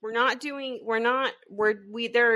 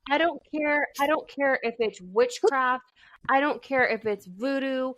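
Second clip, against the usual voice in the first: higher-pitched, emphatic mock demand; playful insistence; 4 semitones higher than usual. Friend demanding crab cakes, witchcraft or not, playfully insistent. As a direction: insist stubbornly, landing each refrain the same way.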